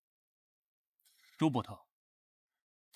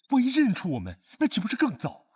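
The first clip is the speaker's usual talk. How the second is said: alarmed worry; 10 semitones higher, 7 dB louder than usual. Acted. Worried warning.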